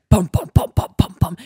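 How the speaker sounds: percussive run of bums; imitated thudding. Climber imitating someone running on the bridge, thudding. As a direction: pound out the bums like heavy steps.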